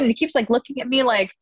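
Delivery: ordinary talk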